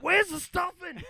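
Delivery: loud, high teasing yell; mock shout. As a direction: bellow the in-joke. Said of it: "I was like".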